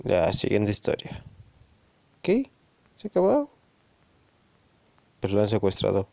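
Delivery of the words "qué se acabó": shocked high disbelief at the ending; can't believe it's over; surprised. Real, not acted. Story ends abruptly, reader surprised. (sorpresa incrédula al final de la historia)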